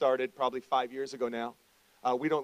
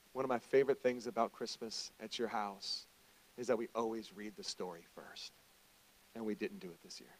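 retold tearful wife; 4 semitones lower, 7 dB quieter than usual. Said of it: "she actually teared up, and she said".

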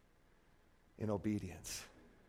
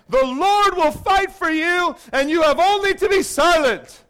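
loud, higher ringing declaration; proclaiming Moses; 6 semitones higher, 4 dB louder than usual. Retold or imitated. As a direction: stand tall and proclaim to the crowd. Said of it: "Moses said to the people".

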